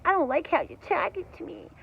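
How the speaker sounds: high, softened complaint in imitation; mock whiny millennial. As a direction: mimic an oversensitive young man. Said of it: "like he was a twenty-year-old millennial".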